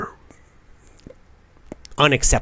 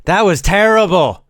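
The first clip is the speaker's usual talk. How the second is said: teacher jokingly demanding more energy; funny coaxing; 5 semitones higher than usual. Shown as a demonstration. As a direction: tease them into more energy. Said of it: "I always do it in a funny way".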